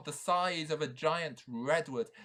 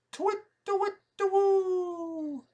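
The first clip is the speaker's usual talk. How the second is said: high, slow hooting owl call; 9 dB louder, slower than usual. Acted. Owl calling to the knight, high hoot.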